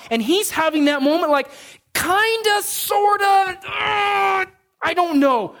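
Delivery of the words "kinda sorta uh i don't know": much higher, squeaky dithering; hesitant Moses. Retold or imitated. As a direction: hedge like a nervous man. Moses hesitating, voiced high.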